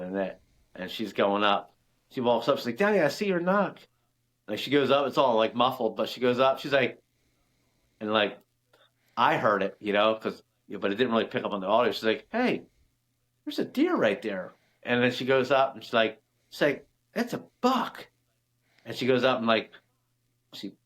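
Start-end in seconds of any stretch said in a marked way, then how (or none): 2.8-3.8 imitating daughter. Daughter calling out finding arrow. 12.3-14.5 imitating daughter. Daughter spotting the deer. 17.1-18.1 put-on high squeaky little-girl voice, thrilled; imitating excited daughter. Dad imitating his daughter spotting the buck, high and excited.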